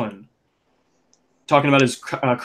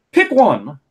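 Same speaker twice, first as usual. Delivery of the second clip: louder, higher, fed-up command; exasperated demand; 6 semitones higher, 5 dB louder than usual. Real, not acted. Podcaster telling Lana to choose an accent, loud exasperated demand. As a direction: snap it out like you've had enough. Complaining about Lana's switching accents.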